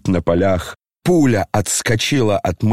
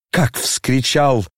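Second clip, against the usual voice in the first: plain reading voice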